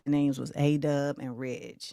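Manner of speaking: upbeat host calling out cities over music; hype livestream host. Acted. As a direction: shout out the viewers like a party host. Hosts livestream roll call over music.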